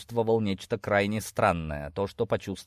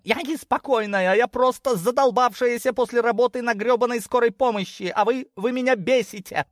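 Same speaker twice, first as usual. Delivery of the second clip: high-pitched, furious tirade; exasperated outburst; 14 semitones higher, 4 dB louder than usual. Acted. Worn-out medic daughter snapping at her parents, furious. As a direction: lose your temper, pitched way up.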